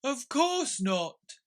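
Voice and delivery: slow, sorrowful creature voice; lofty, mournful turtle; Mock Turtle voice. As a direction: play the weepy Mock Turtle.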